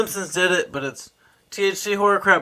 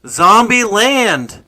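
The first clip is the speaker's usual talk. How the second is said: slow and louder, each syllable; to someone hard of hearing; 6 semitones higher, 5 dB louder, much slower than usual. Real, not acted. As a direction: say it slowly so they hear. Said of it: "Zombie Lake".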